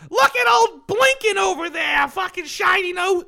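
high, loud jeering reindeer; mocking shout. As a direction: yell the taunt, slow. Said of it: "Everyone's like".

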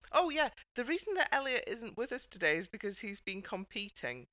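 usual speaking voice

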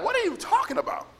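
high-pitched incredulous question; acting out utter confusion, voice jumping up; baffled disbelief. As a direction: squeak it out in mock bafflement. Acts out baffled reply to drifters.

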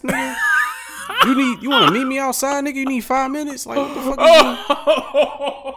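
high, loud outraged yelling, half laughing; disbelieving shout. Real, not acted. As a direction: shout it like you can't believe it.

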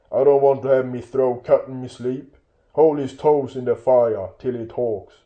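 loud rough-spoken troll; threatening troll. Acted. Bert demanding Bilbo be made to talk, loud threatening troll. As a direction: order it loudly and menacingly.

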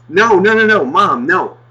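loud, high mock child protest; protesting kid. Kid correcting his mother, loud whiny protest. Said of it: "the kid is sitting there going".

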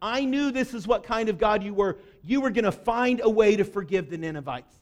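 ordinary talk, nothing marked

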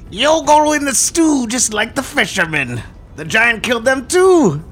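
high, slow cackling hag voice; old hag. Acted. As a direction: sneer it like a witch from the shadows. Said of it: "You hear a cackle from the back".

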